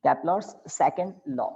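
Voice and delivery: slow heading dictation; dictating. Shown as a demonstration. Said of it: "लिखिए inverted comma में". (शीर्षक धीरे-धीरे लिखवाते हुए)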